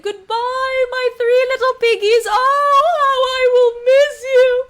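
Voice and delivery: high, loud, hammed-up sobbing goodbye; hammed-up crying mother; mock crying farewell. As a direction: wail like a mother losing her babies. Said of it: "she started to cry".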